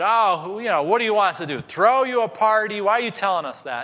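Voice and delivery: high, loud scornful retort; mocking high retort; mocking lady. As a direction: sneer it like a rude diner. Mocking ladies retort in story.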